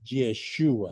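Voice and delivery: "Yeshua" said softly, yeh-SHOO-ah with a falling end; Hebrew pronunciation. Shown as a demonstration. Teacher giving the Hebrew form of Jesus, soft demo. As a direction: quietly give "Yeshua" its Hebrew sound. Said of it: "en la forma hebrea de Jesús es".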